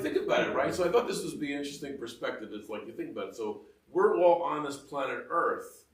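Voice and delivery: usual speaking voice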